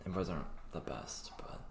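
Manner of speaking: whispered aside, voice dropped out; whispering. Real, not acted. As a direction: drop to a secretive whisper. Hushed aside about paid group.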